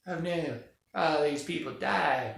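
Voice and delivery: slow, half-hearted lament; mock dismay. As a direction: feign sorrow for movie victims, drawing it out. Mocks expected reaction to deaths.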